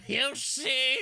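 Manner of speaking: high, slow gloating villain shout; mad scientist. Acted. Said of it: "I am cackling madly at this point".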